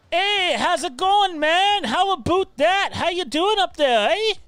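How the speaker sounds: high, drawn-out mock Canadian accent. Host imitating a subscriber's friends in the video, in a mock Canadian accent. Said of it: "the worst Canadian accents".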